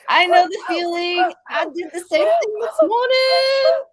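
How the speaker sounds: loud, high, building to a drawn-out cry; jubilant exclamation. Real, not acted. Host shares the feeling of being clean, jubilant shout.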